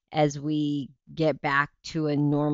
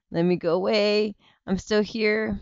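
ordinary talk, nothing marked

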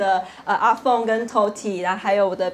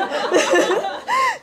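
sudden, high, laughing outburst; high burst of laughter. Real, not acted.

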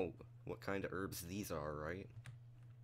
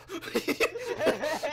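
loud laugh; 10 dB louder than usual. Real, not acted. Laughing at friend on ground.

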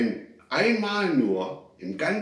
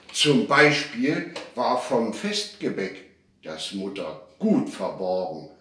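ordinary delivery, nothing marked

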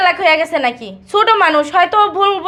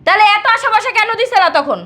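raised-pitch reproach; indignant challenge; 6 semitones higher than usual. Acted. Confronting a spouse over broken promises, indignant. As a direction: demand an answer, voice pitched up.

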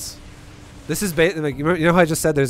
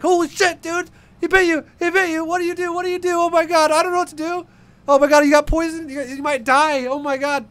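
high frantic panic, yelling; shrieking panicked buddy at a snakebite; panicked friend; 4 dB louder than usual. Retold or imitated. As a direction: freak out like a clueless friend. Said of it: "And it's just panic".

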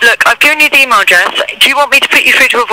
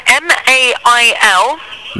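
slow spelling; much slower than usual. Real, not acted. Spelling email to confused caller.

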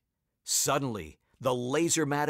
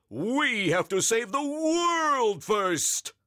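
high, booming superhero cry; heroic shout; 11 semitones higher, 5 dB louder, slower than usual. A narrator's reading. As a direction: call it like a cartoon hero. Captain Underpants commands.

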